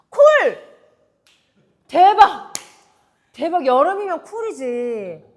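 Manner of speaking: loud, high shriek of joy; shrieking jackpot winner. Real, not acted. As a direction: scream like you won. Shrieking at Cool reveal.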